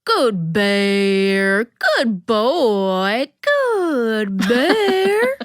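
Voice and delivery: drawn-out cooing call, rising at the end; woman calling. A narrator's reading. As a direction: call to the bear like a pet dog. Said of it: "Then she calls".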